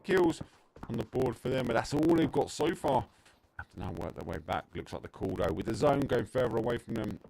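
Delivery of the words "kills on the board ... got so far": high, loud burst of play-by-play; excited commentary. Real, not acted. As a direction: call the kills with a jolt of energy. Commentator reacting to kills.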